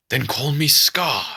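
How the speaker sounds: ordinary delivery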